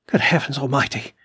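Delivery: ordinary delivery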